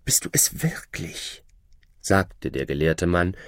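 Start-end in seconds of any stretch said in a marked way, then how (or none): 0.0-1.4 a soft, stunned whisper; disbelieving whisper. Acted. Scholar recognizing his former shadow, in a whisper. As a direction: ask it under your breath in wonder.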